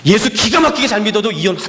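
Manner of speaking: normal talking voice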